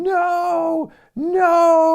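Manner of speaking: high, loud desperate mock yell; shouting. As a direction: scream it like you're being lifted for a slam. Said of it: "Chavo is just shouting".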